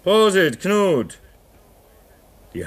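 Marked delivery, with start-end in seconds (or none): 0.0-1.2 higher, louder cheer; toast. Acted. Man toasts his comrades with the last bottle, loud cheer. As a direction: raise the glass and call it out.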